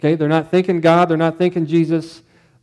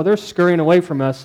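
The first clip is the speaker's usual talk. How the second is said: usual speaking voice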